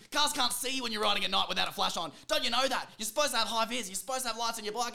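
put-on loud, high furious ranting at a kid; yelling at a kid like an angry old man. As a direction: blast him like a cranky fifty-year-old. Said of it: "switched into, like, a fifty-year-old man".

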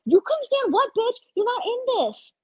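high, indignant outburst at the TV; heated outburst. Real, not acted. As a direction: yell at her like she can hear you. Yelling at Sydney on screen.

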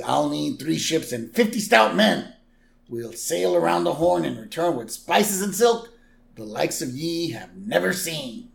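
put-on old sea captain voice; hearty seafarer's proclamation, falling at the end; Captain McAllister. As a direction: declaim it like a salty sailor. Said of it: "Captain McAllister".

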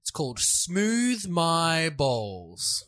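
slow, drawn-out reveal; deadpan reveal. Real, not acted. Cohost slowly reveals the scam company's name. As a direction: slowly let the punchline land. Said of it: "deadpan reveal of company".

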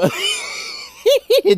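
high squealing laugh; shrieking laugh. Real, not acted. Host shrieks laughing at her own bit.

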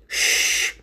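long, urgent shush; drawn-out hushing hiss; whispered hush. A narrator's reading. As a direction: shush them sharply. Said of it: "whispered Tapir".

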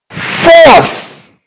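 high, loud single word; shouted answer. Real, not acted. Preacher shouting the answer to the congregation, high and loud. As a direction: bark the word out.

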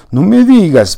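ironic. A narrator's reading. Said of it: "con ironía".

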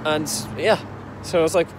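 ordinary delivery

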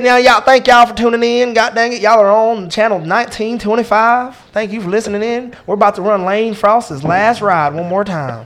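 slow, high, loud country DJ; folksy country broadcast voice, stretched out; country radio host. Shown as a demonstration. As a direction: drawl it like a rural radio announcer. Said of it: "I can do a country".

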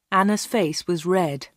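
plain reading voice

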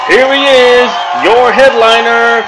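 loud, high, booming stage announcer; announcer intro. Acted. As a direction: hype up the crowd like a ring announcer.

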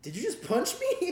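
high, slow, stunned "Did you just punch me?"; incredulous nerdy friend. Retold or imitated. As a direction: play it high and baffled, not hurt. Jacob's stunned reply to punch.

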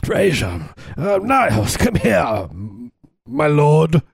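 high, swooping sitcom impression; hammed-up high voice, stretching the last words; Niles. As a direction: call out in a put-on, drawn-out brother's voice. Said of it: "but as his brother, Niles".

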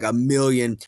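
usual speaking voice

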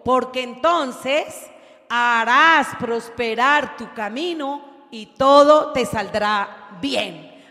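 very slow, loud proclamation; slow preaching. Acted. Preacher proclaiming the promise to the church, very slow and loud. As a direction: hammer each word of the promise. Slow emphatic scripture quote.

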